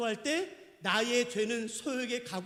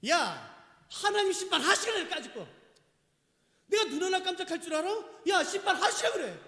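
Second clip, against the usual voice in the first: high, loud mock bravado; defiant scoffing; 8 semitones higher, 5 dB louder than usual. Imitating a scoffer daring God to judge, brazen defiance. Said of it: "그 말은 이 말입니다".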